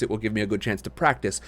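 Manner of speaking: plain reading voice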